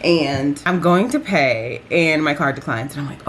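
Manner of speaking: ordinary talk, nothing marked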